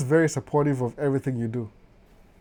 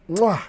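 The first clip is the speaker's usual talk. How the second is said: kiss sound; 6 semitones higher than usual. Pretend. Teasing his wife on the live, smacking kiss. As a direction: blow a big playful kiss. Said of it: "French kiss".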